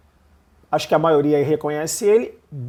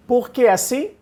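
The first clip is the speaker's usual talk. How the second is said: normal talking voice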